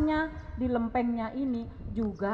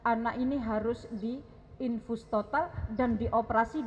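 ordinary delivery

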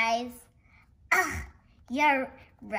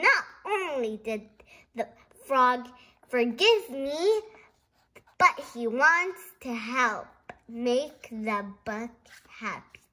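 normal talking voice

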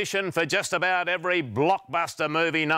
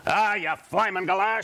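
ordinary talk, nothing marked

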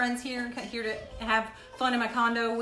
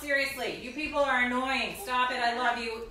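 loud, higher parental telling-off; exasperated scolding; 5 semitones higher, 8 dB louder than usual. Real, not acted. Mom halts her annoying kids at dinner, exasperated scolding.

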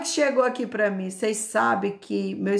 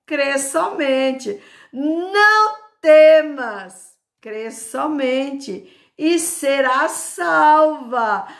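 loud, high, slow proclamation; forceful preaching; 6 semitones higher, 8 dB louder, slower than usual. Real, not acted. Preacher declaring Jesus's answer to the faithful, forceful preaching. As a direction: proclaim the verse, stretching the key words.

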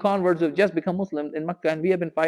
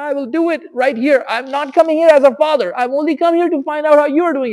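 much higher, loud calling out across the mosque; yelling from afar; 9 semitones higher, 10 dB louder than usual. Retold or imitated. Umar calls out to the Prophet from the mosque entrance, shouting. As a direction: yell it up to someone far away who can't see you. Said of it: "So he yells out from there".

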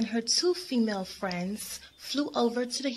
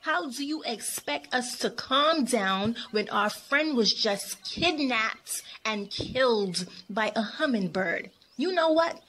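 angry yell; 2 semitones higher than usual. Acted. Miami shaken by her friend's kidnapping, yelling angrily. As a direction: lash out in shocked indignation. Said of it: "She yelled".

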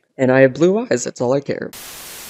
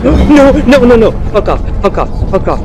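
high panicked pleading; begging it to leave, scared; scared horror game streamer; 9 semitones higher, 3 dB louder than usual. Real, not acted.

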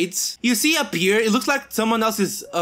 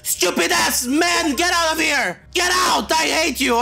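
furious shouting; 7 semitones higher than usual. Real, not acted. Gamer screaming at the bald man to get out, furious shout. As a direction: scream him out of the house.

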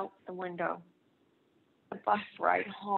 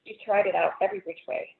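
usual speaking voice

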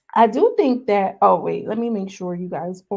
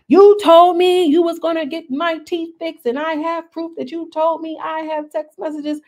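confronting Wet Wet; 9 semitones higher, 5 dB louder than usual. Retold or imitated. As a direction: play the angry cast member demanding her teeth.

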